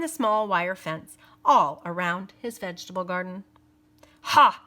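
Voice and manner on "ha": short loud snorting ha; a scornful snort of a laugh. A narrator's reading. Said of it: "he snorted".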